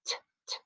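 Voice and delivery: "t" as a soft voiceless tap, repeated; whisper-light "t" sounds with gaps between; isolated consonant. Shown as a demonstration. Teacher preparing to push chips for ten, isolated consonant. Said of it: "let's push chips for ten".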